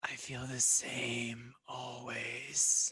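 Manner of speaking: breathy, raspy maze creature; rasping, wheezing creature. Acted. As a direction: answer in a wheezing rasp. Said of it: "rasping, wheezing voice".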